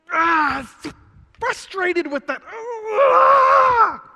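high, loud exasperated yell; fed-up cry building into a shout; frustrated outburst. Retold or imitated. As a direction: vent pent-up frustration. Acting out frustrated person.

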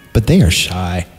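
ordinary talk, nothing marked

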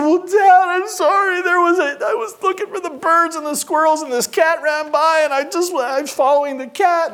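put-on high, breathless child's excuse; imitated whiny apology; pleading little boy. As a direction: babble excuses to dad in a high kid voice. Boy pleading excuse to stern father.

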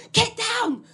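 put-on high, urgent old soldier shouting orders; panicked soldier. Confused old man yelling trench orders to his carer. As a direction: warn of falling bombs in panic. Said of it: "he starts mumbling".

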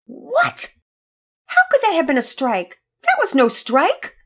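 high, loud, indignant protest; outraged girl disputing the umpire; horrified disbelief. A narrator's reading. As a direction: protest an unfair call in shock. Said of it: "Penelope was horrified".

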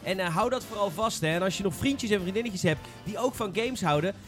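ordinary delivery

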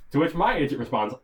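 usual speaking voice

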